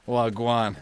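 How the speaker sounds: playful imitation Jamaican patois; Caribbean accent. Playing with a favourite accent for laughs, mock Caribbean accent. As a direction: greet like a mock Jamaican, wagwan-style. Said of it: "I love that Caribbean accent".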